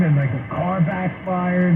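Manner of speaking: ordinary delivery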